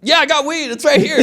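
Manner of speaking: high, half-laughing panicked admission; flustered confession. Retold or imitated. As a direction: blurt it out to the cops. Giving himself up in retold arrest.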